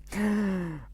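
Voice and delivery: a sharp, high gasp of fake shock; mock gasp. Vlogger gasps at his own bad joke, mock shocked. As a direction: gasp as if scandalised.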